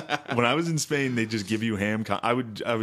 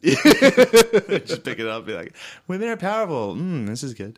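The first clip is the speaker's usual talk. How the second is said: mocking girly voice; 6 semitones higher, 6 dB louder than usual. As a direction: ham up a woman nibbling candy. Mocking a woman eating candy.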